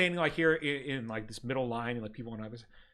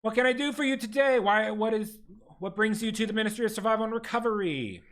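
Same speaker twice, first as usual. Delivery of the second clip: higher, prim bureaucratic clerk voice; desk clerk; 6 semitones higher than usual. Acted. Ministry clerk greets the ragged party, primly bureaucratic. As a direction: recite the rules like a tired official.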